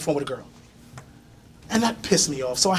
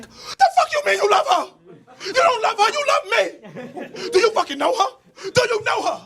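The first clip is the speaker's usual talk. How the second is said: mock screaming girlfriend. Jealous girlfriend screams at him over [name], high shrieking mock voice. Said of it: "starts screaming at me".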